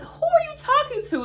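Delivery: high-pitched incredulous challenge; affronted disbelief. Acted. Vlogger challenging an absent friend's tone, high-pitched indignation. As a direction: pitch it way up as if insulted.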